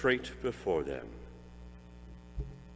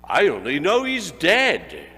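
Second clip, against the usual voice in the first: fat businessman; 7 dB louder than usual. A narrator's reading. Fat businessman answering gossip about the dead man. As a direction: shrug it off, then blurt the news. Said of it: "said a great fat man with a monstrous chin".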